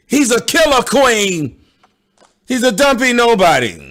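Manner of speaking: mocking drawn-out queeny voice. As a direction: parody him by stretching out the last word. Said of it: "dragging his words out at the end like a queen".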